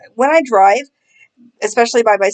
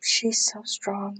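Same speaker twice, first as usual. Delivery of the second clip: very soft, slow imitation of pity; hushed, drawn-out fake sympathy; mock pity; 12 dB quieter, much slower than usual. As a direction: coo it slowly, mocking a pitying onlooker. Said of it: "that pity look".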